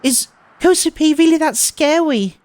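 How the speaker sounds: put-on high, sugary anime-girl voice; moe voice. Transfer student teasing the frightened boy, high moe voice. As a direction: coo it like a cutesy mascot girl. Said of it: "that Mo voice of yours".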